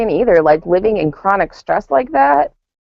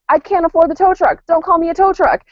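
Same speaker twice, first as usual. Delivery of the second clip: high, exasperated protest; exasperated retelling; 9 semitones higher than usual. Retold or imitated. Exasperated at the pushy helper, pitched high. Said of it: "I'm like".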